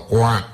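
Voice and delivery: a short high "Cuac" quack; high duck quack. Acted.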